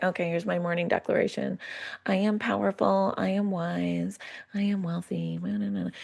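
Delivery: listless morning declaration, just getting through it; going through the motions; faking it. Acted. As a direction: recite it by rote, soft and disengaged. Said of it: "faking it, just being like".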